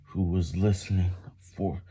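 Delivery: ordinary delivery, nothing marked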